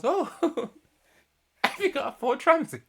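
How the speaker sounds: high, loud laughing quote; laughing squeal. Retold or imitated. Retelling Emma's joke to Kieran while laughing hard. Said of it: "And then Emma goes".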